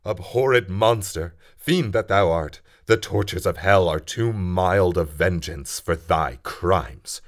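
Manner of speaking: furious defiance. Acted. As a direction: hurl the curse at the monster. Frankenstein rages at the creature.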